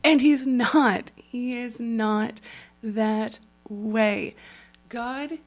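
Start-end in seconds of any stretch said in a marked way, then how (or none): 0.1-4.4 slow and loud, leaning on each word; emphatic denial. Real, not acted. Insisting to viewers God is not angry, slow loud emphasis. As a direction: set the record straight, slowly. Firmly contradicting the judgy image.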